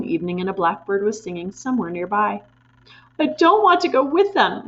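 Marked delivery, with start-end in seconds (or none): 3.2-4.7 high, loud defiant shout. A narrator's reading. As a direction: burst out refusing to go. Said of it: "Matilda shouted suddenly".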